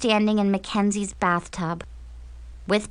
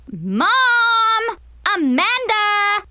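high, drawn-out holler; calling out; 13 semitones higher, 6 dB louder, slower than usual. Acted. Annoyed girl summons Amanda, calling out. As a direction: call a sister from far across the house. Girl yells for her sister.

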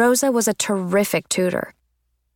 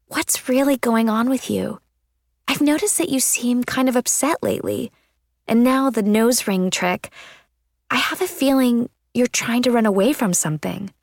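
higher-pitched, caring friend's voice; concerned friend; 4 semitones higher than usual. A narrator's reading. Friend with a hand on Claudia's shoulder, concerned. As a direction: gently probe in a raised character voice.

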